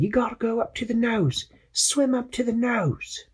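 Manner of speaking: high voice calling over radio; Quasi's voice. Acted. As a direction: call out anxiously for the captain. Quasi directs.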